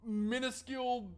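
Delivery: hammed-up horror, breaking into a laugh; mock outrage, sarcastic. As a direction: pile on the fake despair over a trifle. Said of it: "I'm being sarcastic, by the way".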